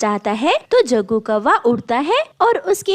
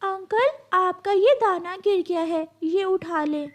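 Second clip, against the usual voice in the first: put-on small bird child voice; 6 semitones higher, 3 dB quieter than usual.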